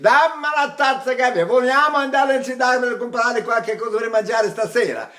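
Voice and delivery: demonstrative, lively Italian speech; speaking Italian. Shown as a demonstration. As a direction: rattle it off like a native Italian. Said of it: "How shall I speak?".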